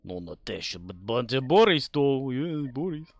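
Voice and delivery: playful mock Russian accent; swooping, joking Russian accent. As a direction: put on a cartoon Russian accent. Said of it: "Do it with the Russian accent".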